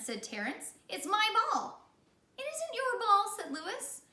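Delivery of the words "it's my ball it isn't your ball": high, loud bickering; high squabbling voices; childish quarrel. Acted. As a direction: argue like schoolkids. Kids arguing over the ball.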